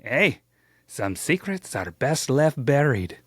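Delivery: slow, hammed-up Irish brogue; badly faked Irish lilt; bad Irish accent. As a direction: put on a corny Irish accent for a sly old brewer. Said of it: "that was my bad Irish impression".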